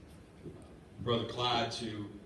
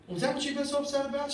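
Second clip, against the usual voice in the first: high, louder motherly voice, amused; mom's gentle chiding; 6 dB louder than usual. Retold or imitated. Preacher voicing his mom soothing her scared son, high and fond. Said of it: "And she said".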